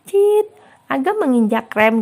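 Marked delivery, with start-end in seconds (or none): 0.1-0.5 high, loud held squeal of brakes; imitated brake screech. Narrator voices the car braking suddenly, screeching. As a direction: mimic tyres squealing to a stop.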